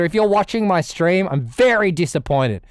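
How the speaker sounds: ordinary talk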